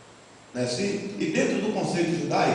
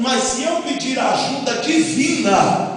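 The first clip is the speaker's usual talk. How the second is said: loud, high full-force preaching; fervent preaching; 5 semitones higher, 7 dB louder than usual. Acted. Pastor preaching to the congregation, loud and fervent. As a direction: preach it out to the church.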